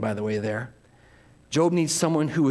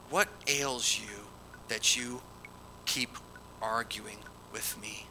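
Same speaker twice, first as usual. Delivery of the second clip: hushed question; 4 semitones lower, 9 dB quieter, slower than usual. Acted. Reading the quoted question.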